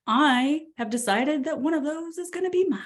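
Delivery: self-assured agent waking up cocky; confident swagger. Pretend. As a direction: sell it like nothing can stop you. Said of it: "Wake up and be like".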